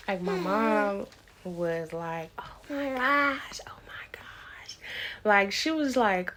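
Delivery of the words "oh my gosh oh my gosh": breathy hushed whisper, slow; slow awed whisper, barely voiced. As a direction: whisper it in awe like a mom seeing her grandson. Mom awestruck at the birth.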